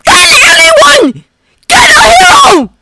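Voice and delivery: high, loud frantic shout. Acted. Canary shouting at Ida to fetch a hero. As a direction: scream for help, desperate. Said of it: "he shouted".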